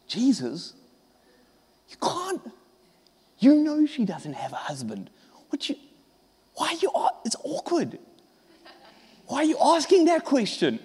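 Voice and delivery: hushed whisper. Acted. Disciples whispering to Jesus about the woman, hushed and awkward. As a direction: lean in and whisper it's awkward.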